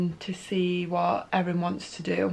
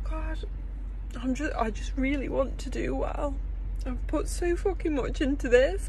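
higher, tearful pleading voice; crying; 6 semitones higher than usual. Real, not acted. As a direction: cry it out, wanting this so badly. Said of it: "I just started crying even more".

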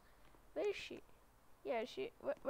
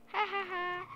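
mocking laugh; 7 semitones higher, 5 dB louder than usual. Real, not acted. Taunting rival driver.

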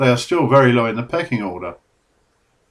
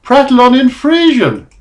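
lightly voiced islander, higher and louder; mock Frisian islander; 11 semitones higher, 6 dB louder than usual. Islander explaining the joke to the confused author, higher, mildly voiced. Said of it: "and he said".

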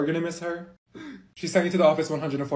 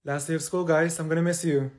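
ordinary delivery

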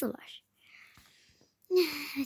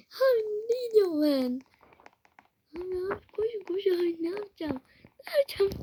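panicked cry; 10 semitones higher, 4 dB louder than usual. Real, not acted. Panic when caught robbing house.

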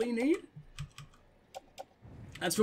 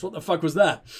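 ordinary talk, nothing marked

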